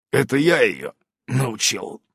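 slow grudging admission; a touch of grudging; reluctant confession. A narrator's reading. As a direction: own up unwillingly. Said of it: "нехотя сознался Иннокентий".

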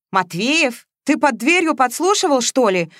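taken aback, half accusing; startled disbelief; surprised. A narrator's reading. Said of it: "удивилась Ленка".

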